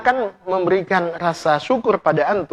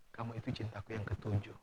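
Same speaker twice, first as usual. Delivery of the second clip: hushed confession; 8 semitones lower, 23 dB quieter than usual. Retold or imitated. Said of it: "husband confesses".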